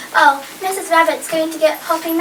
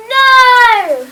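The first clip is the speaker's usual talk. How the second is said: shouted no; 4 semitones higher, 7 dB louder than usual. Acted. Chorus shouts the answer.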